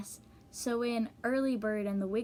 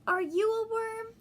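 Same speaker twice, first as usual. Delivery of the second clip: much higher, louder little bird asking; 12 semitones higher, 5 dB louder than usual. Acted. Early Bird asks the thing in the ground, high and eager.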